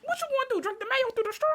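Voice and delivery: high-pitched incredulous squeal; mock disbelief. Co-host reacts to drinking mayo through a straw, high mock disbelief. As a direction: shoot way up high in playful disbelief.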